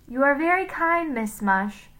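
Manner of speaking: the Scottish gentleman, politely kind. A narrator's reading. Gentleman thanks Miss Mush, in a light Scottish voice. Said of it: "in the Scottish's voice".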